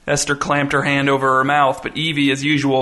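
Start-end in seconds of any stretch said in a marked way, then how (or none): none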